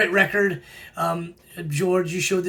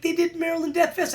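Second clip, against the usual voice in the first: first telling, pitched higher; confident claim; 10 semitones higher than usual. Real, not acted. To the viewers, the first confident claim, said higher. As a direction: announce it like you're sure.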